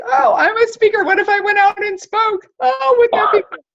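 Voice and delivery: high, bright with laughter; excited realization. Retold or imitated. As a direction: gush with a laugh at a new idea. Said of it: "I'm like".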